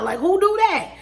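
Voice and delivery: who would even do that, incredulous; incredulous outburst; disbelief. Real, not acted. Ranting about a rude husband.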